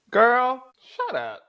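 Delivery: very high, loud teasing squeal; high-pitched mock squeal, girl shut up; squealed high mock girl talk. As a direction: shriek it up high like gushing to a friend.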